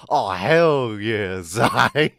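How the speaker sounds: loud, drawn-out mock nasal voice; hammed-up nasal cheer; nasally VTuber. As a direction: play up a nasally streamer, slow and loud. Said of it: "gonna be the next nasally VTuber?".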